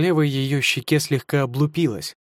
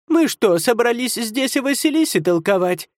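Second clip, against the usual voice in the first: sharp cutting-off; 10 semitones higher than usual. Acted. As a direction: cut him off sharply, high-pitched. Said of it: "Резко оборвала его яга".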